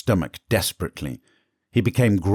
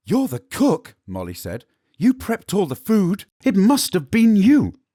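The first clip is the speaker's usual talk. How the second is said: accusing servant; 14 semitones higher, 5 dB louder than usual. Acted. Molly accusing the cook.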